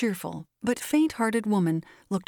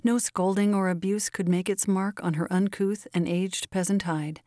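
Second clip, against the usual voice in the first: ordinary delivery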